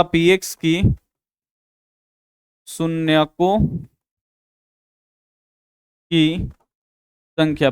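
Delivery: word by word, slow and drawn out, as if writing; slow dictation. Shown as a demonstration. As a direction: dictate each word slowly for the class to copy. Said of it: "यहां पे लिखिएगा".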